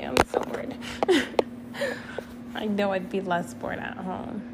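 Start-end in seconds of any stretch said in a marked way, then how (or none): 0.8-2.5 airy giggle that jumps up; giggling at her own boredom; high breathy giggle. Real, not acted. Laughs after saying she's bored.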